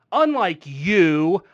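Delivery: UNLIKE YOU, loud and stretched out; pointed comeback. Real, not acted. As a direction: lean hard and slowly on "Unlike you". Heated comeback throwing the phrase back.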